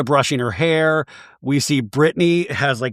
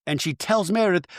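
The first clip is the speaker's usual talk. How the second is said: ordinary delivery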